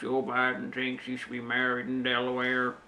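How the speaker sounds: low-key put-on politician voice, actually a Bob Dole take; Joe Biden. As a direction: do a rough impersonation of an American politician. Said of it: "I would just impersonate Joe Biden".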